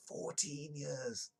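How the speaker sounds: slow, high, mock-amazed echo; drawn-out aside. Reader echoing the show's age in slow mock wonder.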